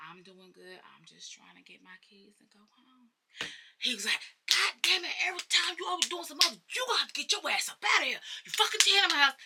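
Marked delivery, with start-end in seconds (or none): 0.1-3.1 very soft, almost whispered answer; meek quiet reply. Retold or imitated. Acts her meek reply to dad. 4.5-9.3 high, fast mock tirade; imitated cussing rant. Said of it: "Blade Daddy is cussing. He going the fuck off".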